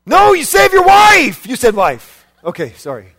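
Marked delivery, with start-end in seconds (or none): 0.1-1.5 loud emphatic shout, high; shouted answer. Acted. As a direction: shout it out to the crowd. Preacher shouts answer to dilemma.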